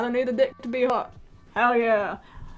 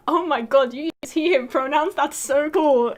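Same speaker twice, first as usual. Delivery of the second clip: loud, higher-pitched gushing; mock gushing; 5 semitones higher, 6 dB louder, slower than usual. Streamer acting out a comment on pronouns, mock gushing. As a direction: gush over it with put-on excitement.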